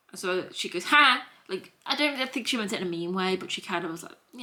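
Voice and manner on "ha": single high, loud "ha"; loud mock laugh, pitched up; imitated laugh. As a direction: mimic a short burst of laughter. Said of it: "I kind of laughed as well".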